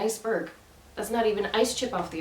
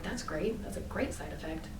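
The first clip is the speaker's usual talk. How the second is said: sarcastic; 4 semitones lower, 10 dB quieter than usual. Retold or imitated. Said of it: "And I'm like".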